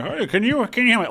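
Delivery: high, dopey local guy; clueless Florida fan voice; Saint Petersburg voice. Acted. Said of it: "Saint Petersburg voice".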